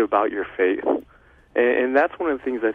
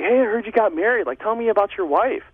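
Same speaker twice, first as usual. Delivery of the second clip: friendly buddy; 8 semitones higher than usual. Retold or imitated. Old buddy asks about wife.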